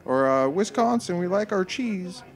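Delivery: high put-on Upper Midwest accent; Minnesota accent. Shown as a demonstration. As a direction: play a cheese-loving local in a Minnesota accent. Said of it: "a Minnesota accent".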